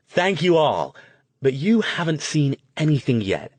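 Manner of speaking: high, loud showman's delivery; excited stage performer teasing what's coming; full of enthusiasm. A narrator's reading. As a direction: work the crowd with big enthusiasm. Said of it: "his voice was pleasant and full of enthusiasm".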